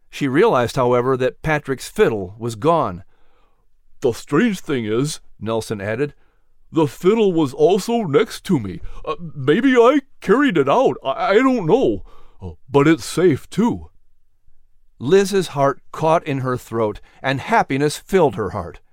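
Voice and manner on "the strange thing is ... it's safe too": lightly voiced dazed hound dog, shaken; a hint of a weary dog recounting danger; Nelson's voice. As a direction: recount the fire, still confused. Nelson puzzled.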